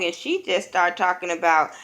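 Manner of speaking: ordinary delivery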